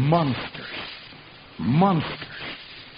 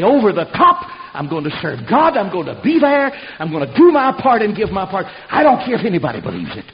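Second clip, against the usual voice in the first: loud, ringing declaration of faith; shouted vow; 4 semitones higher, 7 dB louder than usual. Acted. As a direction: shout it out like a soldier going over the top. Preacher voices a vow to God.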